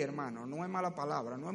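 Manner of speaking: ordinary talk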